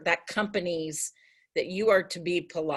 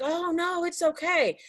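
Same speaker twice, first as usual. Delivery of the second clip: higher, forgiving brush-off; reassuring; 9 semitones higher than usual. Retold or imitated. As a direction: wave off the apology kindly. Others forgiving a snap.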